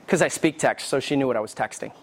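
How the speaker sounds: usual speaking voice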